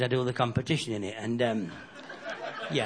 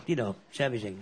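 softer, lower you know, servicing; knowing innuendo; 7 semitones lower, 7 dB quieter than usual. Acted. Comic nudging the crowd about the bull, quiet innuendo. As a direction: drop it quiet like a dirty joke.

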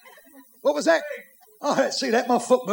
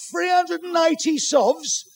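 high, drawn-out incredulous echo; outraged disbelief; 8 semitones higher, much slower than usual. Acted. Husband appalled at what the equipment cost, outraged disbelief. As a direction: stretch the price out in high-pitched outrage.

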